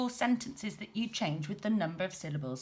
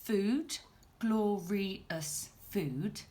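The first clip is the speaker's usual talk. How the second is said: counting syllables; much slower than usual. Shown as a demonstration. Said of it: "So if we count them".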